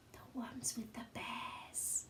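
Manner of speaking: whispered aside. A narrator's reading. Narrator to listening child, soft whisper. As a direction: breathe it like a secret.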